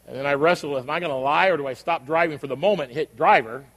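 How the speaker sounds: usual speaking voice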